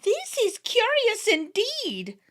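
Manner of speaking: fairy leader feigning surprise; startled leader. A narrator's reading. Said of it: "said their startled leader".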